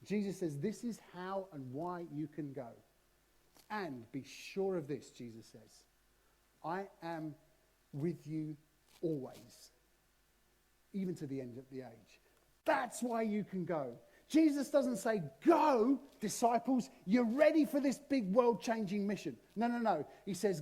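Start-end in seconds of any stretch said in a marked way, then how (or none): 0.6-12.2 soft, slow and tender; calm them like a gentle promise; Jesus reassuring. Acted. Jesus promising disciples he's always with them, softly. 15.4-19.4 louder, higher hyped-up send-off; mock rallying cry. Preacher mocking a pep talk Jesus never gave, loud.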